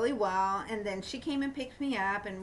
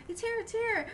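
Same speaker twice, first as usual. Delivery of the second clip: much higher, imitating a thrilled friend; excited squeal; 13 semitones higher than usual. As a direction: squeal it like a friend who just got her card.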